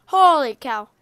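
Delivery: loud, sudden burst of surprise; startled exclamation. Real, not acted. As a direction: blurt it out as the ground drops away. Block destroys the ground.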